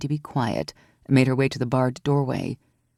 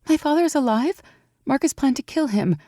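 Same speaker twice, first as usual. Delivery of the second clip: raised voice for Evie, anxious and amazed; young woman Evie, worried; 10 semitones higher than usual. A narrator's reading. Evie amazed that Aurora climbed so high, worried. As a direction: lift your voice high, fretting over the climb. Evie's voice.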